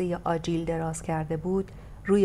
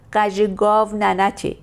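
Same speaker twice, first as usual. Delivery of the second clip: raised voice; 3 semitones higher, 7 dB louder than usual. Acted. Lady raises her voice to silence the rude coachman, loud and commanding. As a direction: shut him down with a raised voice. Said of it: "صدا را بلند کرد".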